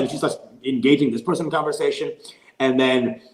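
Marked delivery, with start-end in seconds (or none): none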